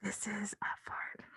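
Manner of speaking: soft breathy whisper; ASMR whisper. Pretend. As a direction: whisper it close to the mic. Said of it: "you should do A S M R".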